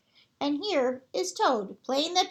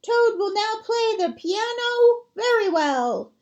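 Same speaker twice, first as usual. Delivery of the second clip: much higher, slow and louder announcement; strange announcer voice; 8 semitones higher, 4 dB louder than usual. Acted. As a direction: boom it out like a circus ringmaster. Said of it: "said the strange voice".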